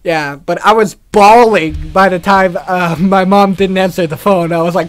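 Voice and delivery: normal talking voice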